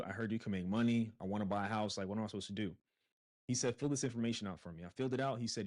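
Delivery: usual speaking voice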